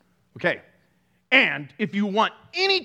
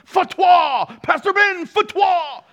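high, loud mock cry of fatwa; mock outraged shout; 10 semitones higher, 5 dB louder, slower than usual. Pastor imitates angry viewers calling out his name, hammed-up loud shout. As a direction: yell it like an outraged accuser, hammed up.

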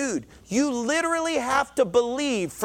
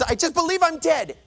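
higher-pitched insistent patient; acted stubbornness; 8 semitones higher than usual. Patient to his doctor, stubbornly insisting. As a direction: dig in, voice raised in pitch.

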